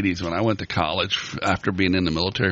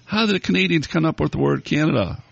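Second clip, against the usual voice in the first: raised-pitch hail across the lake; mock yell; 9 semitones higher than usual. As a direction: call out to Joe as the scoutmaster in a mock yell. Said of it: "and he yells".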